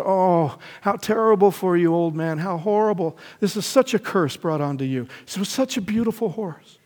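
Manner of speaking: high, loud mock lament; hammed-up crowd lament, high and loud; wailing villagers. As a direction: play the villagers pitying the old man, overdone. Villagers pity the old man.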